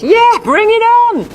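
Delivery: high, slow gleeful dog voice; the dog hearing an invitation, drawn out high; dog's eager reply. Retold or imitated. Dog mishearing its owner, high slow glee. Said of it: "Fluffy hears".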